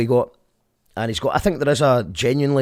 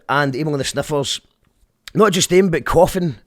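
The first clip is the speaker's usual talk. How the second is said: ordinary talk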